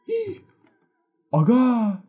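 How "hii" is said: high startled intake of breath; sharp gasp. Real, not acted. Streamer spotting a figure in the house, sharp gasp. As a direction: gasp in real fright at the screen.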